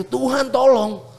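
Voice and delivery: high and slow, "Tuhan, tolong" drawn out; retold desperate cry. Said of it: "Sampai gue seteriak itu".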